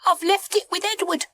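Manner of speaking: very high, quick confession; fast, high-pitched alarm; dismayed monkey. A narrator's reading. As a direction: blurt it out in a squeaky voice. Monkey alarmed at his mistake.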